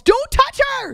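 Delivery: high, loud warning yell; retold yell. Horse guide warning a rider off a spooky horse, yelling. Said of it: "The guide yells".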